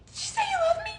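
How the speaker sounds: very high squeaky question; high-pitched hopeful disbelief; giddy surprise. Retold or imitated. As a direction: squeak it out in delighted shock. Chasing her after the door opens.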